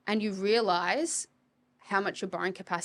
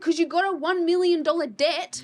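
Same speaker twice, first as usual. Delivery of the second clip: mocking retort; 8 semitones higher, 6 dB louder than usual. Mocking reply to client.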